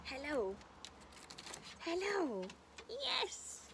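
high, rising pet coo. Real, not acted. House sitter cooing hellos at the two little dogs.

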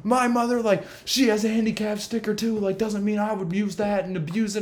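high mocking voice of a preachy stranger; mock older man. Older man lecturing teen about his mother, high mock voice. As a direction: lift into a self-righteous squeak. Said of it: "So he goes like".

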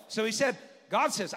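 ordinary delivery

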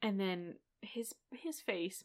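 voiceless, hushed; hushed whisper. Real, not acted. Whispering about his sad face, hushed. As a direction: breathe it out in awe.

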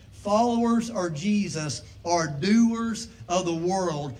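normal talking voice

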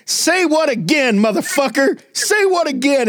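high squeaky Elmo playing tough; a puppet threatening like a movie gangster. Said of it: "Elmo's like".

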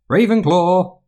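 high, ringing announcement of the house; Sorting Hat call. A narrator's reading. The hat calling out the new student's house.

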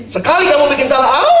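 high, loud mock yelp of pain; God smacking at the first mistake, high mock yelp. Imagined God punishing at once.

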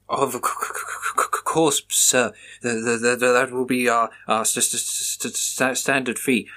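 heavy stammer, tripping on every word; nervous clerk who can't get a word out; stuttering clerk. Acted. Desk clerk stammering at the officer, nervous stutter.

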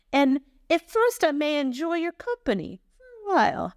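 hammed-up Italian-American reading; Italian accent. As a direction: read it out like an Italian uncle. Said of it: "read this with an Italian".

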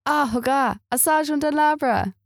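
"Ah, regarde, un singe dans l'arbre" high and slow in French; French phrase. Shown as a demonstration. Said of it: "I've definitely pronounced that wrong".

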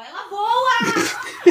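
shrieking in panic at a flying bug; high terrified squeal, dragged out; startled scream. Real, not acted. Cockroach flies, panic.

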